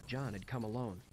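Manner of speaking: plain reading voice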